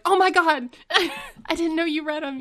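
excited surprise. Retold or imitated. Retold surprise at boss reading blogs.

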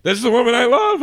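high, strained declaration; a man pretending to be in love; forced fake sincerity. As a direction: force it out through a fake face. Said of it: "put the face on and be like".

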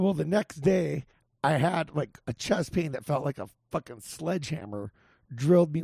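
ordinary delivery

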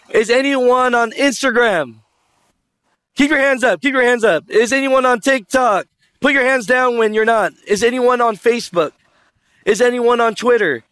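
high, projected crowd poll; rally the room to keep hands up; calling to audience. Real, not acted. Polling the audience for raised hands.

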